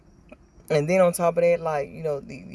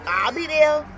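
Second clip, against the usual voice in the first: loud, high, instant yes; eager acceptance; 9 semitones higher, 8 dB louder, slower than usual. Retold or imitated. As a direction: jump at the invitation, acting out an eager party girl. Acting her old eager acceptance.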